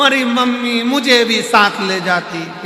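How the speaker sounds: loud pretend sob-cry; mock wailing. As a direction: ham up a grieving wail. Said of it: "फिर रोई".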